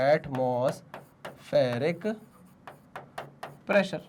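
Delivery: "atmospheric" drawn out very slowly, pause before "pressure"; dictating while writing. Real, not acted. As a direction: stretch "atmospheric" syllable by syllable, softly. (लिखते हुए "atmospheric" बहुत धीरे, खींचकर बोलना)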